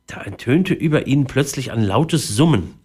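plain reading voice

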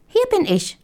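high piping mouse voice, pleased; delighted mouse squeak. A narrator's reading. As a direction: chirp it up high as a happy little mouse. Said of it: "piepte vor Vergnügen".

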